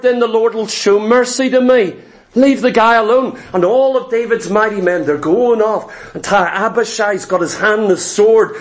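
ordinary talk

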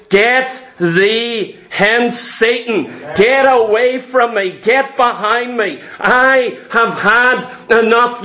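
each word slow and deliberate, held firm; slow stern rebuke. Retold or imitated. Jesus turning on Satan: slow, stern commanding rebuke. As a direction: rebuke him slowly, word by word. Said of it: "turns to Satan the last time and says".

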